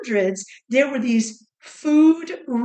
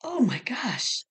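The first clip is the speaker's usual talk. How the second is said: breathy shocked whisper; 5 dB quieter, slower than usual. Real, not acted. Host whispering in dismay at haggis. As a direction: gasp it out under your breath.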